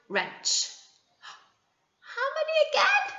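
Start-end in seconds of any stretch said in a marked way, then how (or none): 2.2-3.0 sing-song question. Acted. Teacher quizzing young children.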